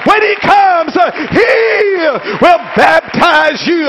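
high, emphatic sermon cry; climactic preaching. Acted. Preacher proclaiming the baptizer to the congregation, climactic preaching. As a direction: proclaim it high, drawing out the key words.

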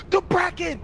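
high, loud sudden cry; jump-scared yell; startled shout. Real, not acted. Startled by the monster.

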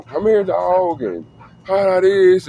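high, drawn-out mock dog call; frustrated calling. Owner acting out calling a runaway dog, frustrated. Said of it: "I'm getting frustrated".

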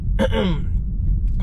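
clears a rough throat; quick throat clear. Real, not acted. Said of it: "don't wanna keep clearing it on camera".

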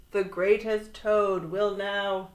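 loud, slow proclamation; strange announcer voice. A narrator's reading. Voice starting another act before interruption, proclaiming. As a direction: begin a grand drawn-out introduction. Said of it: "Then the voice said".

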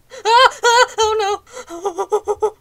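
high, loud nervous laugh; scared laughing cry. Real, not acted. Player startled in the game, laughing in panic. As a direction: squeal in fright, laughing.

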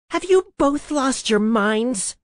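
high, loud, drawn-out scream of disbelief; screamed over chaos. A narrator's reading. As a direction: scream it slowly over the din. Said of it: "Olivia screamed over the chaos".

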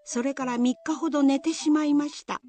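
usual speaking voice

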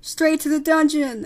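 loud, high mob shout. Acted. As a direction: shout from among an accusing crowd. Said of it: "another voice shouted".

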